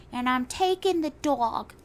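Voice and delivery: high, loud celebrity impression; Jennifer Coolidge. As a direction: ham up the diva line. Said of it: "Jennifer Coolidge is like".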